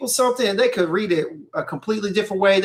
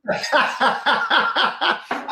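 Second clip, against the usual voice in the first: high rapid cackling laugh; long run of high staccato laughter; 6 semitones higher than usual. Real, not acted. Host cracking up at co-host's joke, high laughter.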